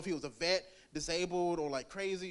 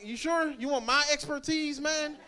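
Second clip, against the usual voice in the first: sarcastic; 9 semitones higher than usual. Retold or imitated.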